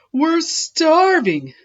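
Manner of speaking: high, slow, loud whine; whiny complaint. Acted. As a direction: drag it out hungrily. Hungry friends complain.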